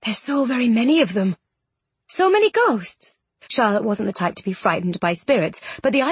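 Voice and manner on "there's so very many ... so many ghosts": marvelling, rising much higher at the end; awed, pitch climbing; murmured wonder. A narrator's reading. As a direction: take in the crowd with growing wonder. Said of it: "shaking his head at the wonder of it all".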